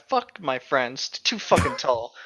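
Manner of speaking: ordinary delivery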